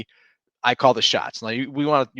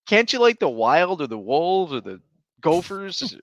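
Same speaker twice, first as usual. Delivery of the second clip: higher, whiny pleading; mock pleading; 7 semitones higher, 3 dB louder than usual. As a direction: beg the son, half joking. Pleading with son, joking.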